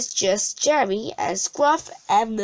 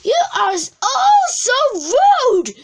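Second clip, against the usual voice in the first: furious witch shout, much higher and louder; 7 dB louder than usual. A narrator's reading. Winnie screams at her rude party guests, furious shout. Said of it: "she shouted".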